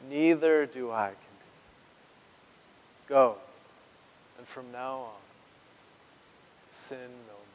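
very slow, soft and gentle; Jesus forgiving the woman, slow and soft; tender Jesus. Acted. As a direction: let each word fall gently, forgiving. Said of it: "tenderness in Jesus' voice".

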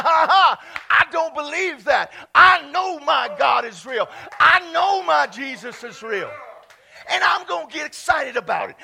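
loud declaration of faith, building to a shout; defiant full-force preaching. Real, not acted. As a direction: shout it down at the doubters.

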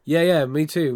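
husky with a cold; rough, croaky under the weather; hoarse sick voice. Real, not acted. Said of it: "you can totally hear from the tone of my voice".

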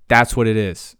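loud, emphatic declaration; sudden yell. Real, not acted. Guest declares the vegan biscuits point with a real yell. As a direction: burst out with the point at full voice. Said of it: "Sorry, I didn't mean to yell at you".

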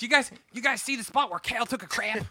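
high-pitched mock Baltimore accent; hammed-up Baltimore gawker. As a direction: play an excited Baltimore local. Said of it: "That accent is funny".

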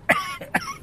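sharp choking cough; a hard cough from the throat; coughing up water. Real, not acted. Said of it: "Went down the wrong pipe".

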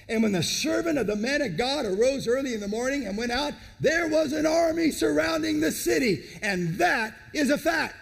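scripture read out loud and rising; preaching at full force. Acted. Preacher reading the army surrounding the city, building loud.

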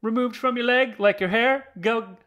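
hammy commercial announcer; mock ad read. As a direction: sell it like a cheesy ad. Mock ad read for wax strips.

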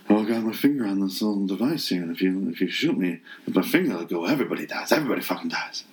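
whispery mock Southern drawl; hushed Southern drawl, running words together; Steven Seagal. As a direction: imitate a mumbling action star with a weird Southern drawl. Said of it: "his whispers, he, he's got a weird southern drawl".

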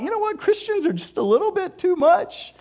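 high mocking voice of a skeptic; skeptical listener. Preacher voicing a doubter of Christians, high and mocking. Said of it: "if you've ever thought".

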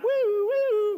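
high steady woo woo of a siren; siren imitation. Telling the pulled-over story, imitating a siren. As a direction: mimic a police siren.

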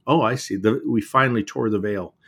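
usual speaking voice

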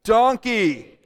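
group call-out. Shown as a demonstration. Pastor leads congregation chanting donkey, loud. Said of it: "let's say it together on three".

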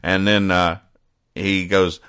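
ordinary talk, nothing marked

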